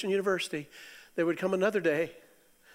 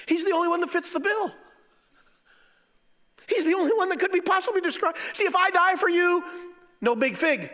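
high, loud triumphant proclamation; a loud, high, ringing sermon line; fiery preaching; 12 semitones higher, 5 dB louder than usual. Acted. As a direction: drive the point home from the pulpit. Preaching at full force.

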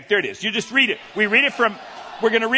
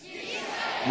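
group call; 12 dB quieter than usual. Shown as a demonstration. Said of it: "Now, Yisrael".

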